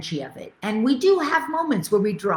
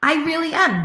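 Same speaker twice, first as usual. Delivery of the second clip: much higher and louder, beaming; excited yes; 10 semitones higher, 5 dB louder than usual. Retold or imitated. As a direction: burst out with delighted agreement. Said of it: "And I'm like".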